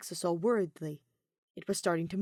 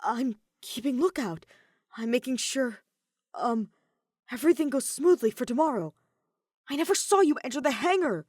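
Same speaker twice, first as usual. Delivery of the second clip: frantic excuse; 6 semitones higher, 6 dB louder than usual. Acted. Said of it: "frantically clawing for a good excuse".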